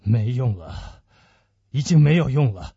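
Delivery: low, heavy resignation; deep, grave despair; hopeless. Acted. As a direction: pronounce that all hope is gone. Grave man says nothing can save her.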